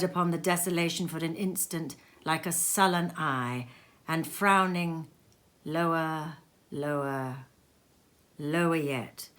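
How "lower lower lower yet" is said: sinking lower. A narrator's reading. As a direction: let the voice sink with each word. Sun sinking, words sink too.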